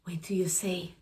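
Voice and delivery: a breathy secret whisper, very soft; storyteller whispering to the children. Acted. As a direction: lean in and whisper the tease. Narrator's hushed aside to listener.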